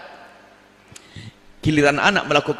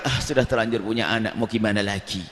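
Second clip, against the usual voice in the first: resigned sigh; 6 semitones lower, 3 dB quieter than usual. Acted. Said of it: "yang hanya berkata".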